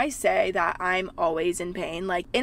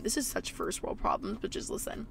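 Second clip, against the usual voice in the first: whispered aside; 9 dB quieter than usual. Real, not acted. Aside to viewers.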